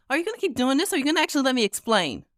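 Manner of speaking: small, high huffy voice; impatient young gamer. Acted. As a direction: plead to be allowed to explain.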